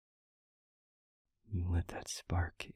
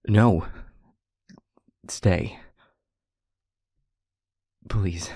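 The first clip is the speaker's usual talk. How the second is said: urgent plea; 12 dB louder, much slower than usual. Acted. Begs friend to stay.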